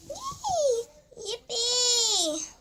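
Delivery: squealing high yay; gleeful kid doll squeal; excited cheer. Pretend.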